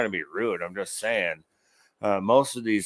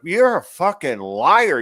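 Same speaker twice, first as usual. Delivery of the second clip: louder, slow accusing snap; slow angry jab at a politician; angry retort; 5 semitones higher, 8 dB louder, slower than usual. Retold or imitated. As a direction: call him a liar to his face, slowly. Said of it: "It's like".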